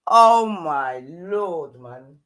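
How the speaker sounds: Oh my Lord dragged out very slowly; a long, weary, disbelieving groan of the words; exasperated groan. Real, not acted. Exasperated at the clip.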